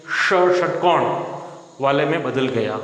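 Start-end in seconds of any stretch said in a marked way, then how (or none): 0.1-1.2 "ष" said slowly as a retroflex sh; retroflex sh sound. Shown as a demonstration. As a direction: stretch out "षटकोण" with the tongue curled back. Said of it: "व स है वो मूर्धन्य". ("ष" को मूर्धन्य उच्चारण में धीरे बोलें)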